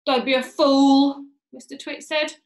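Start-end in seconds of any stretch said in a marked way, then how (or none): 0.1-1.3 loud, drawn-out put-down; scornful scolding. Acted. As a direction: scold your wife loudly and slowly. Mr Twit scolding his wife.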